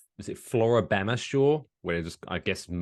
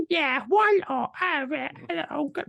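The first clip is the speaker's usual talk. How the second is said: squeaky high flustered starlet voice; imitating a 1920s actress who can't talk; mock silent film star. Silent star asked for an interview, in a squeaky high voice. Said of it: "And she's like".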